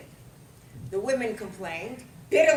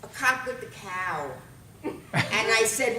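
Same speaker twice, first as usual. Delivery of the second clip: ordinary delivery, nothing marked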